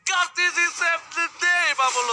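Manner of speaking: esports caster. Acted. Hyped play-by-play call.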